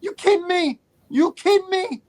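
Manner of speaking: very high-pitched, incredulous retold outburst; worked-up repeating. Man acting out his theater rant, indignant repeating. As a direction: hammer the same question twice, voice way up. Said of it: "start to do the repeat thing".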